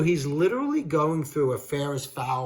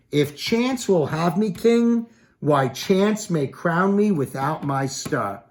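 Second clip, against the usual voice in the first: slow, much higher and louder, declaimed; dramatic Shakespeare reading; 4 semitones higher, 4 dB louder, slower than usual. Acted. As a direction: stretch out the line like a stage actor. Reads Macbeth's decision line.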